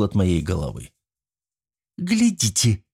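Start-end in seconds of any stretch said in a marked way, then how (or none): 2.0-2.9 high, delighted cry; joyful exclamation. A narrator's reading. Alisanna hitting the prisoner, delighted high cry. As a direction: cheer at a lucky throw. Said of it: "воскликнула Алисанна, радостно".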